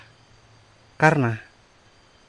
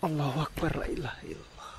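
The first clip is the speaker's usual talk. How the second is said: whispered prayer; 2 semitones higher, 9 dB quieter than usual. Real, not acted. Ghost hunt, praying under breath.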